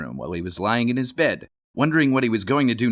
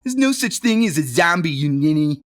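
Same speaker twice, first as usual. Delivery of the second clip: put-on high, loud scornful kid; scoffing bully; 7 semitones higher, 5 dB louder than usual. Billy scoffs at Johnny's zombie, high and loud. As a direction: brush him off with scorn.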